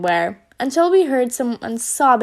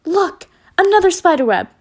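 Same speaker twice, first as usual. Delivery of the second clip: louder, higher startled cry; scream; 6 semitones higher, 5 dB louder than usual. A narrator's reading. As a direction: yelp at the sight of the web. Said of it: "Then she screamed".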